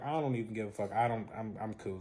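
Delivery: low, soft, dropping away; quietly claiming not to care; retold indifference. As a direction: act unbothered, voice sinking. Acting unbothered after being left.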